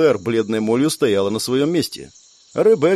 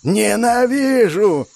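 high, long-drawn howl of hatred; possessed yell; 9 semitones higher, 3 dB louder, much slower than usual. A narrator's reading. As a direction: scream it out slowly, as if possessed. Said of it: "орала одержимая".

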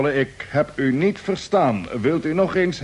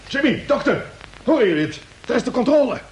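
excited call; 8 semitones higher, faster than usual. Acted. Calling crew to the radio.

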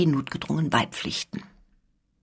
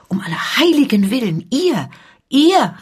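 higher and louder, aghast; shocked cry; 6 semitones higher, 4 dB louder than usual. A narrator's reading. Scuderie horrified by the count's confession, shocked cry. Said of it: "rief die Scuderie".

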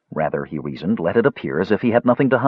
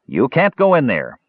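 firm, slightly raised warning; stern; 5 semitones higher than usual. A narrator's reading. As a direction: forbid it sternly. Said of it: "Tanis said sternly".

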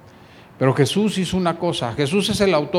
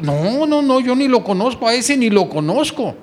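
high, loud insistent denial; heated denial; 6 semitones higher, 4 dB louder than usual. Acted. Peter loudly denies Jesus while cursing. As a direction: swear you never knew him.

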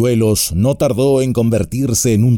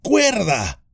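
high, excited cry; excited exclamation; 9 semitones higher, 3 dB quieter than usual. A narrator's reading. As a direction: burst out with relief. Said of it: "exclamó Sam, excitado y aliviado".

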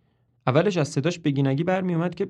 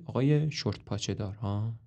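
softer, unimpressed repeat; sour echo; 4 semitones lower, 6 dB quieter than usual. Acted. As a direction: mutter it back dryly. مروین دوباره میگه.

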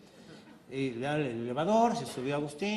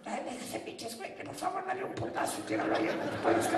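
dying whisper; 10 semitones higher, 4 dB quieter than usual. Acted. Wife whispering, faking dying.

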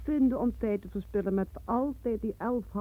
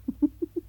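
sly laugh; 8 semitones higher than usual. Acted. Laughing at crude joke.

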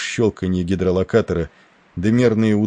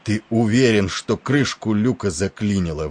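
usual speaking voice

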